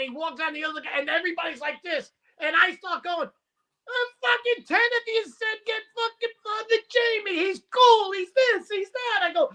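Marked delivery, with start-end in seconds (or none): 3.3-8.1 high-pitched, indignant retold complaint; exasperated protest. Comedian defending himself to the golfers who egged him on, exasperated. 8.1-9.2 high-pitched, indignant listing; exasperated mimicry. Comedian quoting the golfers' praise back at them, exasperated.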